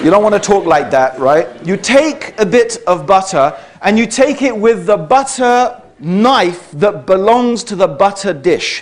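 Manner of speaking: ordinary talk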